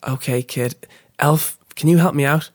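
usual speaking voice

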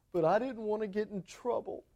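mock whimper, soft and high; tearful child. Caught child pleading with his parent, mock tearful. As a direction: softly imitate a crying child's plea. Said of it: "he begins crying. And he says".

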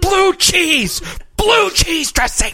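high, slow emphatic protest; mock indignant correction. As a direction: insist on blue cheese. Mock outrage over ranch.

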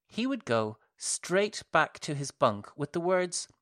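ordinary delivery, nothing marked

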